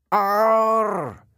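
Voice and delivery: loud high howl of pain; pained monster yell. A narrator's reading. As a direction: roar out in agony. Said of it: "Medusa yelled".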